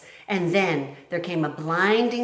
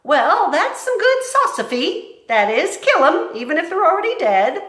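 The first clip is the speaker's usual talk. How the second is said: admiring Mig; 12 semitones higher, 9 dB louder than usual. Acted. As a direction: repeat it in awe. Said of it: "said Mig".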